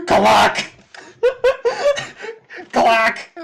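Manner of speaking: high, loud clacking sound with laughter; mock clack noise. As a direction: bark out a cartoon clack. Imitating a sound effect, laughing.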